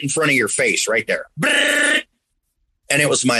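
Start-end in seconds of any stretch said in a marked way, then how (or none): 1.4-2.0 high rapid brrr like flicking cards; quick high trill; shuffling noise. Retold or imitated. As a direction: imitate cards riffling past. Card shuffle sound imitated.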